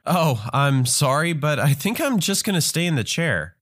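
ordinary delivery, nothing marked

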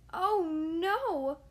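alarmed cry. Acted. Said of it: "cried Squirrel".

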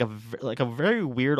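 ordinary talk, nothing marked

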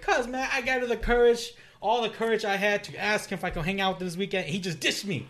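a mock aggrieved rant; upset retelling. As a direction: vent to classmates about being ignored. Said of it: "So I went to class angry".

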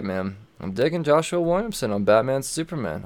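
ordinary talk, nothing marked

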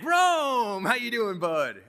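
high, loud, beaming hello; cheery buddy greeting. Retold or imitated. Old boss greets Jim on the phone, high and hearty.

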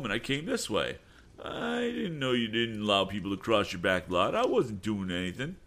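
put-on high, whiny young voice making excuses; sneaky bully. Sam excusing himself to Tom, whiny. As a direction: sound innocent while lying.

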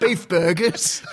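slow husky Clinton drawl; Bill Clinton impression. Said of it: "do a Clinton voice".

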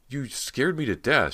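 high, loud, slow strained outburst; choked anger. A narrator's reading. Startled girl to the man who grabbed her, choked anger. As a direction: choke it out angrily, drawing out the words. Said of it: "she managed to choke out angrily".

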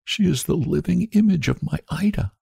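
a grieving mother choking up; breaking voice. Acted. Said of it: "spoke in a breaking voice".

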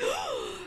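sharp high gasp, breathy and soft; mock gasp. Host reenacts gasping at the Jersey reveal, a sharp mock gasp. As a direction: act out a shocked gasp.

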